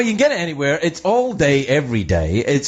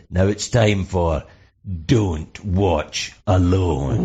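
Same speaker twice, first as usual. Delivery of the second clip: lower, slow, drawn-out horror host; spooky TV announcer; 6 semitones lower, much slower than usual. Retold or imitated. Late-night Scottish TV host introducing the horror film, slow spooky drawl. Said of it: "the guy would come on and say".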